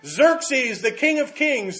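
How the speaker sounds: high-pitched herald announcing a king; herald's proclamation. Acted. Herald presenting Xerxes to his vast court, proclaiming. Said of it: "somebody proclaimed him".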